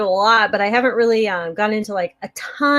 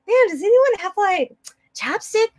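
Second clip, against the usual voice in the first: higher-pitched mock plea for a favor; imitating a casual asker; acted request; 12 semitones higher than usual. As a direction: lightly put on someone asking around, high. Voices someone asking around for chapstick.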